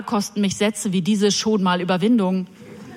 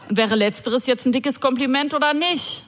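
ordinary talk, nothing marked

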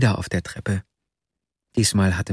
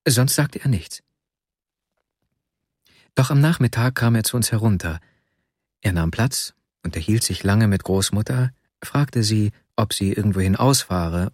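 plain reading voice

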